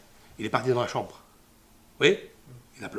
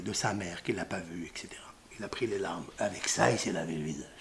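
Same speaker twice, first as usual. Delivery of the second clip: whisper; 9 semitones lower, 11 dB quieter than usual. A narrator's reading. Retelling Yosef weeping alone.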